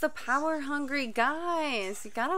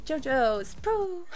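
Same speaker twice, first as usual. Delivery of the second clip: ordinary talk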